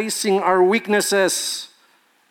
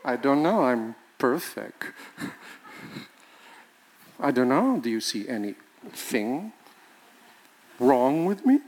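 mock self-righteous spouse; 5 semitones lower, 4 dB quieter than usual. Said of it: "always about him".

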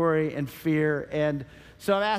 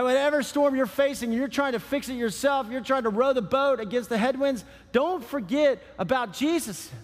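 higher, driving preaching; urgent exhortation; 5 semitones higher than usual. Real, not acted. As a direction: press the congregation with rising insistence. Preacher urging the congregation.

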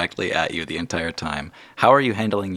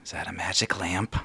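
put-on hushed, secretive child's question; whispered so nobody else hears; conspiratorial whisper; 6 dB quieter than usual. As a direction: lean in and whisper it. Leans in close, secretive question.

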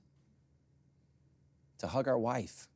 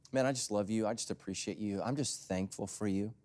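tender; 4 semitones lower, 6 dB quieter than usual. Pretend. Example of encouraging word.

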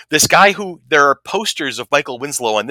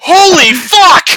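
drawn-out, very high, very loud yell; full-blast imitation of a starstruck fan; ecstatic fan scream; 13 dB louder, much slower than usual. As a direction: scream in stunned delight, stretching each word. Acts the stunned fan's outburst.

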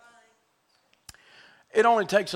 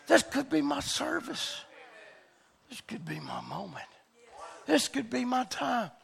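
much softer, suddenly held back; hushed preaching; 4 semitones higher, 8 dB quieter than usual. Real, not acted. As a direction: drop the sermon to a hush. Preacher drops to a hush.

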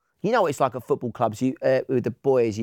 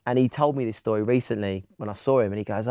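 ordinary talk

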